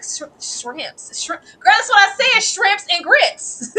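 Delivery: high, loud insistence, slow on the last words; correcting her. Acted. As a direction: insist on how she said it, then drag out shrimps and grits. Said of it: "She was like".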